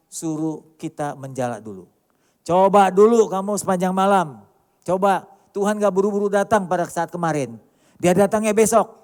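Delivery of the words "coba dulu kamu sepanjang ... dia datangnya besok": louder, emphatic sermon push; forceful pulpit emphasis; loud preaching. Acted. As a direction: raise the voice and hammer it home. Preacher presses the point loudly.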